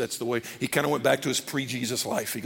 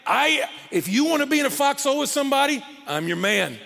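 higher-pitched, gung-ho disciple bragging; brash and bold, pledge loyalty; cocky bravado; 8 semitones higher than usual. Acted. Peter boasting to Jesus he'll fight for him, cocky. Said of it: "confident, even cocky".

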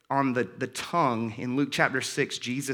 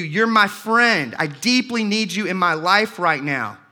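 higher and much louder, pleading, slowing on "You're my friend"; impassioned preaching; 6 semitones higher, 6 dB louder than usual. Acted. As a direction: plead it like a man longing for his friend. Pleads Paul's longing for Timothy.

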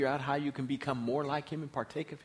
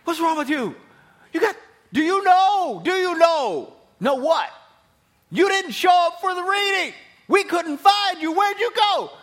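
high, loud shouting in disbelief; incredulous shout; 17 dB louder than usual. Retold or imitated. Preacher shouting at a careless heir who skipped the will reading. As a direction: yell it like you can't believe them. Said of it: "You'd look at them and say".

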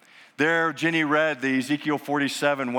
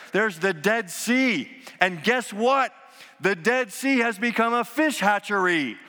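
full-force preaching; 8 semitones higher than usual. Acted. Pastor to congregation, proclaiming the future at full force. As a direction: lift the crowd with each line. Preacher climax, loud and high.

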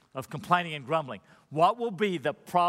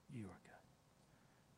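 barely voiced, reverent whisper; hushed echo of the line before; whispered prayer; 7 semitones lower, 30 dB quieter, much slower than usual. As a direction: breathe it out as a whisper.

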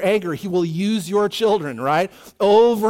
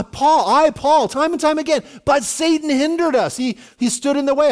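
higher, urgent dramatized quoting; impassioned frustration; 7 semitones higher, 4 dB louder than usual. Acted. Paul explaining to the church why he never came, frustrated. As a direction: plead it as the thwarted apostle. Voicing Paul's frustration at being hindered.

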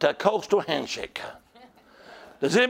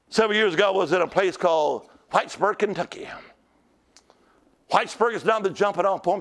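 normal talking voice

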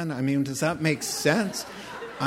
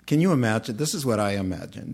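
ordinary delivery

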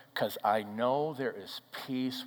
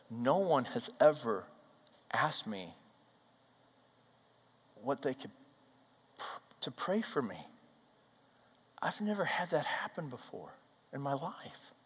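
soft, halting, broken-off retelling of a tearful stammer; a quieter retold stammer; 2 semitones higher, 4 dB quieter than usual. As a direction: let the words catch and restart, softly. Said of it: "she was stammering".

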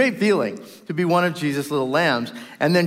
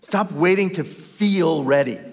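ordinary delivery

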